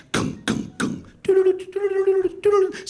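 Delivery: high loud robotic beeps; Terminator sound effects. Retold or imitated. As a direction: beep like a scanning robot. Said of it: "I shop like the Terminator".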